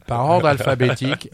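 laughing through the words. Real, not acted. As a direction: tease him while laughing. Host laughing while teasing guest. (en riant, il taquine l'invité)

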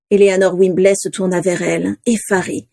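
plain reading voice

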